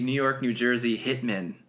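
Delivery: ordinary delivery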